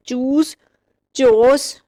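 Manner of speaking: ordinary delivery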